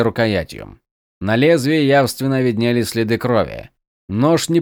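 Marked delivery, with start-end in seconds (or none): none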